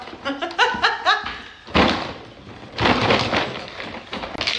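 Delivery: long, helpless laughing fit; burst of laughter. Real, not acted. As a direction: laugh hard and keep it going. Fou rire avec sa mère. (long fou rire)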